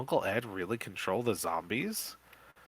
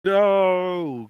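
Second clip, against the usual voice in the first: loud, much higher shrieked no; 10 semitones higher, 11 dB louder than usual. Acted. Coach Crump shrieks as Uncle Ed grabs the gun, loud shriek. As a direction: shriek it in panic. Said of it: "Coach Crump shrieks".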